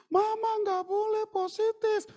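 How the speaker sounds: put-on high, slow little child's plea; small child's voice. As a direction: youngest child begging mum not to be sick. Youngest child pleading with mother.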